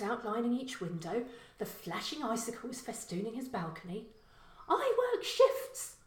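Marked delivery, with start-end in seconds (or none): 4.7-6.0 much higher, slow, snapped female voice. Acted. Girl defending herself to her neighbour, snapping. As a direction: snap it at him like a sour young woman. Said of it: "the girl snapped".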